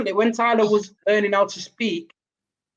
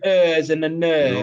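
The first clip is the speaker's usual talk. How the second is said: Hull vowels drawn out on "ers" and "ner"; slow mimicry of Hull "ers" and "ner"; mock Hull accent; 3 semitones lower, slower than usual. Mum showing how her partner sounds, mock Hull accent. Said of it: "takes a mick out of Laura's accent".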